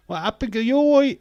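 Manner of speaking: very loud, high London yell; over-the-top shouted London accent; hammed-up London accent. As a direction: holler the catchphrase in broad London. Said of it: "That's, uh, off TikTok".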